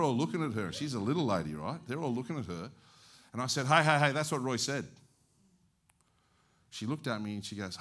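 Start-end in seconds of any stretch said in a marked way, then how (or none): 3.7-4.9 loud, raised-pitch interjection; calling out. Retold or imitated. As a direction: call out to settle the onlookers. Pastor calls out to stop objectors.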